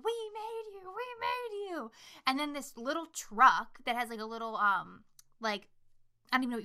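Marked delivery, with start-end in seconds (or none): 0.0-1.9 high, softened mock wail; thin high imitation of a scream, held back. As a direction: imitate screaming at reduced volume. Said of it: "she's screaming".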